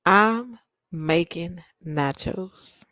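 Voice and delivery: drawn-out daydream; slow dreamy repeat. Pretend. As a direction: savour each word.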